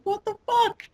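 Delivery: very high squeak of mock astonishment; amazed disbelief. Fan retelling amazement at the underdog's wins, squeaky disbelief.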